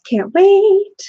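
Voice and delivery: can barely contain excitement; eager. Real, not acted. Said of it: "eager about making videos".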